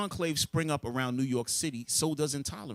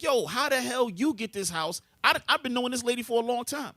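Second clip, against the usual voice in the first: higher, slowed indignant challenge from an upset neighbour; 9 semitones higher than usual. Retold or imitated. Angry neighbour confronting the new owners, indignant. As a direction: demand to know how they got the house.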